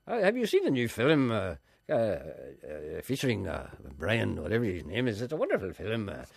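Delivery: put-on affected Ulster arts-club accent, swooping pitch; old literary Ulster accent. Club regular praising a film, affected Ulster accent. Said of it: "talking like that".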